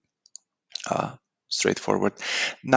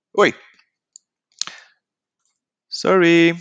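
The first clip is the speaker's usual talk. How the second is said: startled sorry; 9 semitones higher, 6 dB louder, slower than usual. Real, not acted. Realizes she skipped a page.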